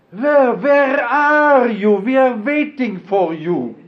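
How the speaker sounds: high, slow, loud eager call of welcome; calling out where are you, eager and high; quoting Zina. Retold or imitated. Old man voicing Zina's welcome, high and loud. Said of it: "And she says".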